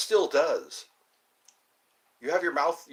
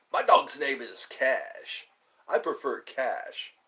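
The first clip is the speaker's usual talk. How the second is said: slow deadpan; 2 semitones lower, slower than usual. Pretend. Repeats cue card joke.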